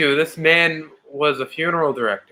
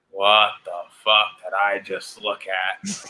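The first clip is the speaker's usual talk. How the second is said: lower, slow New York dad; thick New York accent; 9 semitones lower, 4 dB quieter, slower than usual. Retold or imitated. Dad reacting to the match in a lower, slowed New York accent. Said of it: "my dad will say in his thick New York accent".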